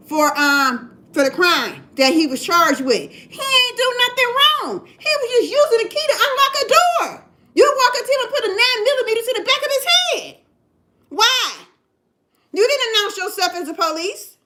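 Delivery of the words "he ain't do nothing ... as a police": high, raised protest; a mother protesting her son was held at gunpoint; indignant outrage. Real, not acted. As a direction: let the outrage rise over the injustice. Angry protest over son's arrest.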